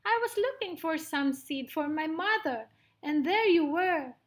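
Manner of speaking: high mouse voice explaining; little mouse, earnest. Acted. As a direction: explain innocently as a tiny mouse.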